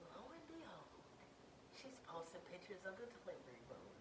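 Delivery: very soft, suspenseful storytelling; hushed build-up. Real, not acted. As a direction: drop right down before the big reveal. Hushed build-up to the big reveal.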